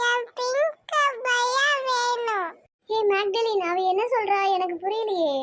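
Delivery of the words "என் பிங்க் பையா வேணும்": high, drawn-out tearful wail; little child crying in a higher voice. Acted. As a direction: wail slowly for what you want. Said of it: "சொல்லி அழுகுறா".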